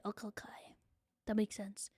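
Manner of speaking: breathy whispered aside to herself. Real, not acted. Streamer whispering an aside to chat. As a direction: drop to a whisper, just for you.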